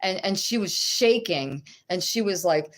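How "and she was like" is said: usual speaking voice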